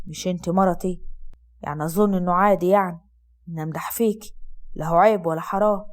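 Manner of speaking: low, gentle man's voice; Asad's low male voice. Acted. Asad flirting, low.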